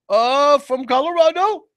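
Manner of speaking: high-pitched fake Pakistani-accented agent; mock Pakistani accent. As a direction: imitate a Pakistani agent fibbing. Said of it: "You're in Pakistan".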